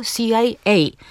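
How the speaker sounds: "C I A" in English letter names, si-aj-EJ; stress last syllable. Shown as a demonstration. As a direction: lean on the final letter of "C I A". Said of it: "tak samo jest". („C I A” z akcentem na EJ)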